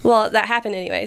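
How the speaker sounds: ordinary delivery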